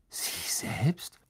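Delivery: breathless, soft whisper of disbelief; astonished whisper. Acted. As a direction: breathe it out in awe. Hermine astonished at Lupin.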